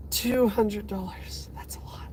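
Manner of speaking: slow, hushed disbelief fading to almost nothing; awed whisper. Real, not acted. Streamer taking in a two hundred dollar gift, awed whisper. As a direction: whisper it, stunned by the amount. Stunned by size of the donation.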